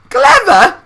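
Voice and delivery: put-on very high, loud old man's cry; cried out. A narrator's reading. Grandpa Joe crying out at the word clever, very high loud cry. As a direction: scoff in amazement. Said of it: "cried the old man".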